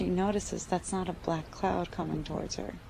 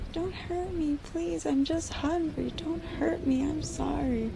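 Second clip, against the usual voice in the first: much higher, small begging insect voice; pleading mosquito voice; 9 semitones higher than usual. Acted. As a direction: play a tiny hungry bug begging for mercy. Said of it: "these mosquitoes start to say".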